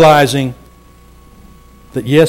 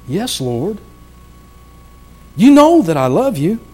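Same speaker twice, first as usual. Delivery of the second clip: higher, strained plea; the word "know" lifted high with hurt; pleading Peter; 6 semitones higher than usual. Acted. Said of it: "with a little emphasis here in the way I think Peter was responding".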